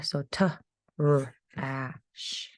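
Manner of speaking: "Trash" then split into "t", "r", "ash", each sound said apart; teacher sounding out a word for first graders. Shown as a demonstration. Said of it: "a couple sounds in here".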